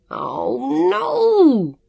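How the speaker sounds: high, loud wail of dismay; almost scream it, pitch shooting up; anguished cry, stretched out; near scream. A narrator's reading. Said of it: "Jonathan almost screamed".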